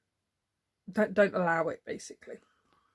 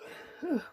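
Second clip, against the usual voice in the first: creaky yawn; 8 semitones higher, 6 dB quieter than usual. Real, not acted. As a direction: let a real yawn creak out. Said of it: "creaky door as I yawned there".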